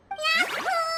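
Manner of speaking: high, drawn-out happy yahoo; joyful cheer. Acted. Linnea celebrating her eureka moment, gleeful yahoo. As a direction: let out a gleeful whoop.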